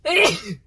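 short harsh cough; a single hacking cough; loud cough. Real, not acted. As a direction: cough it out sharply. Still irritated nose.

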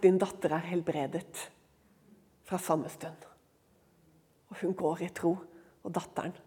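low, soft, measured pronouncement; solemn tenderness. Acted. Jesus granting the woman her daughter's healing, solemn tenderness. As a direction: drop right down into hushed reverence.

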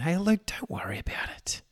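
soft whisper coaxing him to skip it; the lazy voice in his head, whispering; whispered temptation from his own mind; tempting inner whisper. Retold or imitated. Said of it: "my mind's just saying".

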